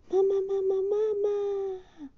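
very high little dragon crying for mom; the baby dragon calls for its mother, very high; baby dragon calling. Acted. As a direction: squeal for mama like a baby dragon.

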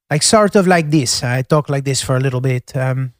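lightly Italian-tinged English with British vowels; a mild Italian accent learned in the UK. Shown as a demonstration. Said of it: "a mild Italian accent with a tinge of a British accent".

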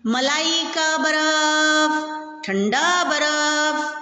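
high, slow drawn-out street cry; slow hawker's cry, pitched high; ice cream seller's call. A narrator's reading. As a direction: sing out like a hawker down the lane. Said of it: "बर्फ वाले की आवाज गूंज रही थी".

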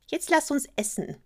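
high, good-humoured frog voice; cheerful frog. A narrator's reading. Frog at the table invites the princess to eat, cheerfully. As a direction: chirp it like a frog in a good mood. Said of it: "meinte der Frosch gut gelaunt".

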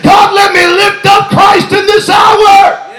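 loud, rising preacher's shout; preaching at full force. Real, not acted. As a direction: let the fire build to a cry. Stirred preaching, building again.

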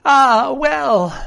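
high, loud sighing exclamation; sailor's drawn falling cry; castaway character. Acted.

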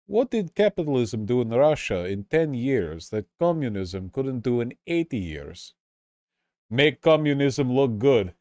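mock Russian accent, slowed down on the last lines; Russian accent joke. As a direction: deliver the joke like a deadpan Russian comic. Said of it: "I have to do this in, um, in the accent".

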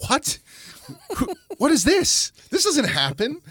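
high-pitched, laughing disbelief; stunned disbelief. Retold or imitated. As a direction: squeal it out in shocked surprise. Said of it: "It's like".

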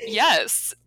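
usual speaking voice

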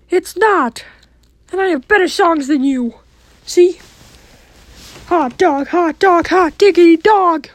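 Mickey Mouse voice. Acted. Mickey brags about his songs.

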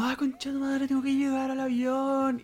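mucho más agudo, exclamación atropellada de apuro; grítalo como quien corre desesperado a no perder el avión; pánico apurado. Retold or imitated. Corre para alcanzar el avión. (corriendo por el aeropuerto, apurado y agudo)

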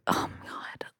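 whispered, awed oh my God; hushed oh my God. Retold or imitated. Recalling the dinosaur's mother's death, a hushed whisper. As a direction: breathe it out in a whisper. Retelling sad scene, hushed reaction.

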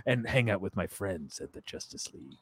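soft, low Batman voice; quiet Batman impression. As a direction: mutter it like Batman. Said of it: "Batman's got friend".

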